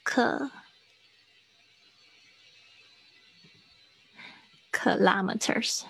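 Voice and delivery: a soft, isolated 克, then kilometers slowly as kuh-LAH-muh-ters; syllable-by-syllable demonstration. Shown as a demonstration. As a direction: sound out kilometers quietly and slowly from 克. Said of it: "它的音标在上面". (先轻念“克”，再慢慢念kilometers)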